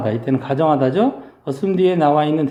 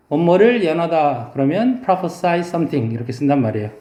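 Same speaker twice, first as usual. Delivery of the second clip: usual speaking voice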